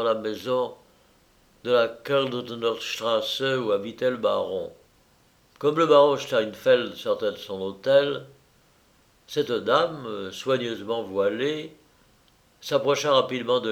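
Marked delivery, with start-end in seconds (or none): none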